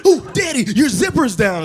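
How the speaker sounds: put-on high, sing-song child voice; excited little kid. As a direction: squeal it like a five-year-old spotting a truck. Son voiced again.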